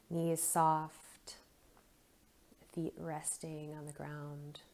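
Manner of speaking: soft phrases with long, restful pauses; guided meditation. Acted. Guide scanning down the body with listeners, quiet meditation voice.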